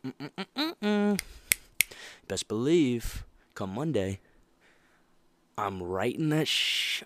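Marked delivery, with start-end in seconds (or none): 0.0-1.2 high hum. Pretend. Imagined reaction on the team.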